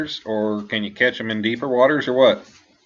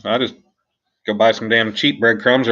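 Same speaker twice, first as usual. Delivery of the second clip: ordinary talk, nothing marked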